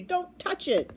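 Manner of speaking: high urgent warning; shouted warning. A narrator's reading. Boy warns trumpeter off oobleck, shouted warning. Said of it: "shouted Bartholomew".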